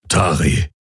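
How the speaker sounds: dark, restrained call of a name. A narrator's reading. Hawks calls the guard leader's name, dark voice. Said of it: "Es war Hawks dunkle Stimme".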